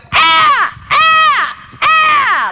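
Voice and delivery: high, loud martial arts ki up; demonstrated yell. Shown as a demonstration. Instructor shows the audience how to yell, high loud ki up. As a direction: yell a short, explosive "Ah!" on each strike. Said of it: "I want you to go".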